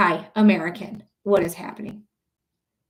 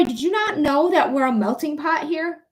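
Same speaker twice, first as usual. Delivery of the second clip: ordinary talk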